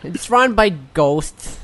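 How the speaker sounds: lisping character voice. Acted. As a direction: speak with a light lisp. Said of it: "Now you have a lisp".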